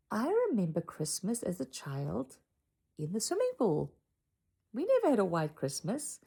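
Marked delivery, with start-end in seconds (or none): none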